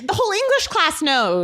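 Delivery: high half-shouted gossip; a friend yelling the gossip; retold shout. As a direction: blurt it out like scandalous news. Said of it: "She goes".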